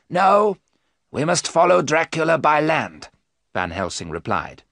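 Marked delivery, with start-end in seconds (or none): 0.1-3.1 firm refusal. Acted. Professor overruling Arthur.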